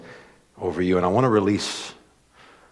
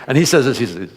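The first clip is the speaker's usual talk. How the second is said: usual speaking voice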